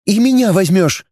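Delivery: put-on girl Dana pleading. A narrator's reading. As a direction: plead lightly, girlish. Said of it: "взмолилась". (девушка умоляет, выше голосом)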